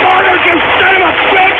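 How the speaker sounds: high, fast furious yell at a caller; outraged screaming insult; furious shout. Real, not acted. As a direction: blow up at him. Host explodes at caller name.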